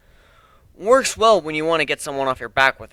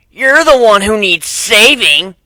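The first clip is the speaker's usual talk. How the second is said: loud, higher, slow menacing retort; bully threat; 8 semitones higher, 13 dB louder, slower than usual. Acted. First bully sneering back at the narrator, loud slow menace. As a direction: threaten him slowly and loudly.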